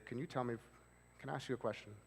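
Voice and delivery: soft, gentle question asking permission; quiet and steady, invite them to listen; a soft counselor's check-in; calm question. Shown as a demonstration. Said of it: "the reason I say".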